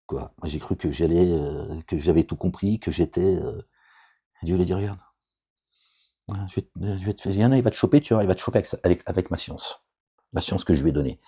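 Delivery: ordinary delivery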